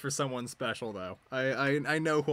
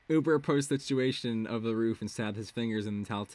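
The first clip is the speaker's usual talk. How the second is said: ordinary delivery, nothing marked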